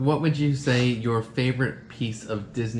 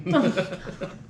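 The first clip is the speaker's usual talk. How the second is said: laugh; 8 semitones higher than usual. Real, not acted. Laughs at British Revolution joke.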